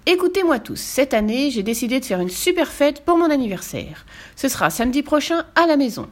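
high-pitched announcement to the whole group; announcing. A narrator's reading. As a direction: call everyone to hear your news. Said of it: "Lou annonça".

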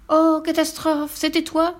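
high, slow fake shock; mock dismay. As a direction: pretend to be horrified. Fifi hits Buck with coconut.

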